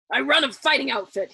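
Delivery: loud, stern general's command. A narrator's reading. General scolding his cowering men, loud and stern. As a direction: lay down the law to the men. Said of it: "he told them sternly".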